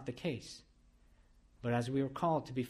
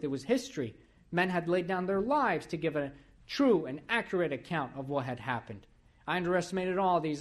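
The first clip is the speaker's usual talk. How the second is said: louder, higher preacher's build; fervent preaching; 4 semitones higher, 4 dB louder than usual. Acted. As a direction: preach it with rising fire. Preaching testimony, rising force.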